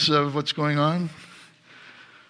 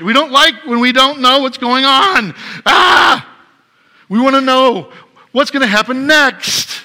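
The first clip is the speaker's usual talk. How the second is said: frustrated shout; 8 semitones higher, 6 dB louder than usual. Acted. Preacher acting out frustration.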